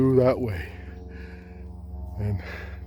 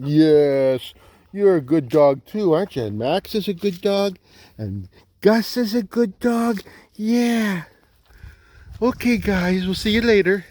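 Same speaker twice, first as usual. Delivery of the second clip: talking to a dog; 7 semitones higher than usual. Real, not acted. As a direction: coo goodbye to the dogs. Talking to dogs.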